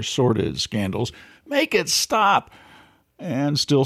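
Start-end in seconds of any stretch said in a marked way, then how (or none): 1.5-2.5 exasperated plea. Pretend. Whining about endless campaign.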